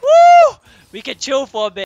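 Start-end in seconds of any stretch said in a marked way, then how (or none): 0.0-0.6 very high, loud whoop; shrill celebratory yelp; excited whoop. Real, not acted. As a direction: let out a piercing "woo". Celebrating in the game.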